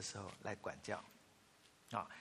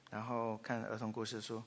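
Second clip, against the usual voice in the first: ordinary talk, nothing marked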